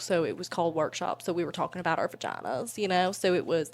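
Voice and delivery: ordinary delivery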